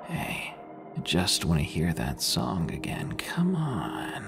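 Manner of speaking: eerie pleading whisper; creepy whisper. Acted. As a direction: whisper it like a giggling intruder. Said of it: "giggling under his breath".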